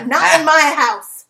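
loud, slow scolding; stern judge. Retold or imitated. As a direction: lay down the law slowly. Playing Judge Judy.

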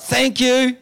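imitated hearty yell, pitched high; yelled thanks. Rough-looking man yells across car park, imitated high yell. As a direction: call out a big high thank-you. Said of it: "he yelled out".

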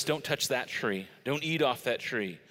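ordinary delivery

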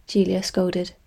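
ordinary delivery, nothing marked

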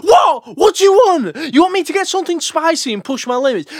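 high, loud, fast frustrated yell; exasperated shout. Retold or imitated. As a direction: shout back at the mates who mock you. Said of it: "and you're like".

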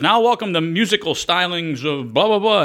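slow, drawn-out mock emcee intro; lightly mocked stage announcer; offstage announcer. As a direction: ham up a bored offstage announcer. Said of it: "off stage, someone says".

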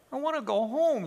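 high, tearful little wail; sniffling. A narrator's reading. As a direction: plead like a homesick little lamb. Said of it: "he sniffled".

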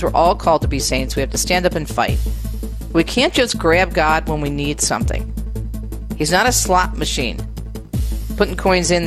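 ordinary delivery

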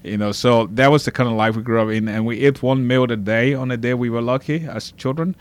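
normal talking voice

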